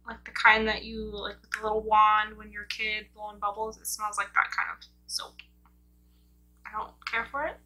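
face covered by wet sheet; through a sheet mask. Real, not acted. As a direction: lips framed by mask hole. Seen 0: Sheet mask worn on face, mouth opening over lips throughout.

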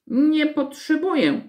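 high-pitched huffy refusal; acted high, miffed crow voice; offended young crow. A narrator's reading. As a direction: refuse like a sulky little bird. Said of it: "bardzo obrażona".

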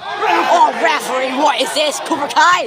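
loud outraged appeal; pleading with the referee. Real, not acted. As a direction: shout in disbelief at the ref. Complaining to the referee.

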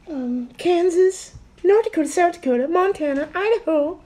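squeaky put-on falsetto; high-pitched voice. Naming twenty states for a drinking dare, high-pitched voice. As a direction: talk way up high in a silly falsetto. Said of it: "Talking a high-pitched voice for one minute".

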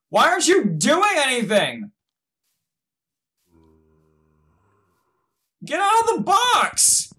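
very high, loud frantic yelling; exasperated screaming. Real, not acted. Player yelling at idle fighter Greg, exasperated screaming. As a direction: scream orders in outraged panic.

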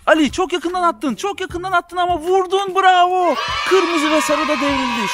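usual speaking voice

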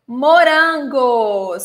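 slow, drawn-out Morangos, each syllable clear; Portuguese word slowly. Shown as a demonstration. Teacher modelling the fruit word slowly for students. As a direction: spell out Morangos slowly for learners. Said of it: "são morangos".

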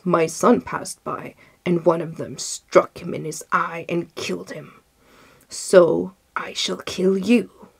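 forceful, accusing genie voice; wrathful genius. A narrator's reading.

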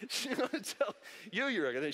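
cracking up at own joke; amused, words broken up by laughing. Real, not acted. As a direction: talk through real laughter. Joking about wife, breaks into laughter.

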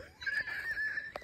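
very high squeal of laughter. Real, not acted. Handler after voicing the silly snake, squealing laugh. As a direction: break into a high squealed giggle.

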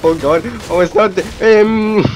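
loud, high panicked babble; panicking as it all falls; terrified gibbering. Real, not acted. Continued panic.

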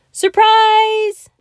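high, loud burst of surprise; big, high-pitched gleeful announcement; excited shout. A narrator's reading. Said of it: "Franklin shouted to his parents".

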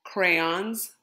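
ordinary delivery, nothing marked

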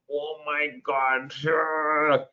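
drawn-out, acted dismay; mock dread at the question paper; panicked exam reaction. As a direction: stretch it out like a stunned student. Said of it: "I'm like".

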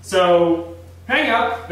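ordinary delivery

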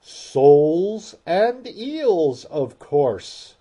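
loud, slow, impatient reply. A narrator's reading. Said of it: "replied rather impatiently".

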